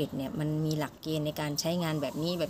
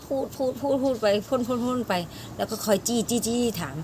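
higher-pitched run of repeated words; relentless chattering; 5 semitones higher than usual. Retold or imitated. Foreign teacher pressing a student with questions, mock relentless chatter. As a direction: imitate a teacher firing words without a break.

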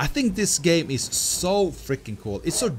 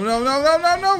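panicked no; 12 semitones higher, 7 dB louder than usual. Real, not acted. Panicked as the creature jumps.

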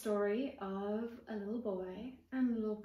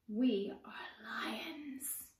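very slow breathy whisper; secret whisper; 4 dB quieter, much slower than usual. Acted. Little sister whispering to her brother they're lions, hushed. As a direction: let them in on a secret.

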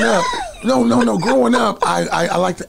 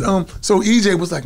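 normal talking voice

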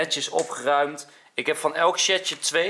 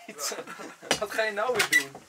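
put-on high squeaky childish shout; helium voice; 11 semitones higher than usual. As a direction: whine it high like a cartoon kid.

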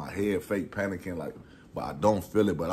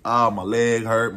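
slow, loud hammed-up groan on "Ah, my leg hurt"; faked pain; 4 semitones higher, 8 dB louder than usual. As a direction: overplay the hurt to get rid of the police. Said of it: "I'm lying".

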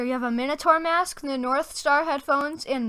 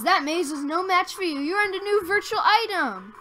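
excited; 6 semitones higher, 4 dB louder than usual. Real, not acted. Reads the victory message.

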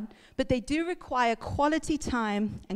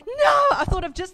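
laughing panic; 10 semitones higher, 5 dB louder than usual. Retold or imitated. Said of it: "I'm panicking now".

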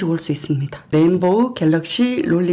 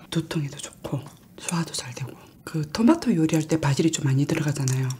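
speaking between bites; chewing while talking. Real, not acted. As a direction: mouth partly full of bagel. Seen 3: Holds bagel, takes bites near 3.7s and 12.9s, chews between phrases.

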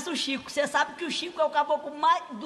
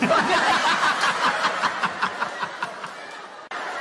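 helpless laugh after the punchline; laughing at own joke; 5 semitones higher than usual. Real, not acted. Friend telling jokes, cracks up at his punchline.

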